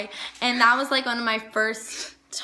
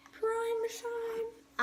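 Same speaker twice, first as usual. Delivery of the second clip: high character voice; 13 semitones higher, 8 dB quieter than usual. Acted. And I loved her voice.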